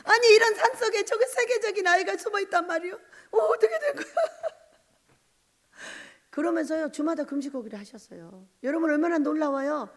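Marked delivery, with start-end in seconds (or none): 0.1-4.7 high, laughing-crying disbelief; laughing astonishment. Real, not acted. Preacher retelling the visiting pastor's shock, laughing and crying. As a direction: laugh through the amazement.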